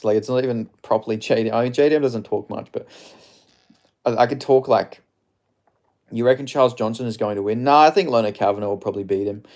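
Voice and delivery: ordinary talk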